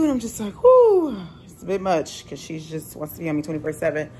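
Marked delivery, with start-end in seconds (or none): 0.6-1.2 high, loud "whoo" of relief; exasperated whoo. Retold or imitated. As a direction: let out a high, worn-out whoo. Acts out her overwhelmed reaction.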